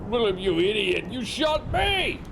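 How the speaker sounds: high, stretched-out yell at the shooter; acted wounded fury; angry shout. Shot man yells at shooter.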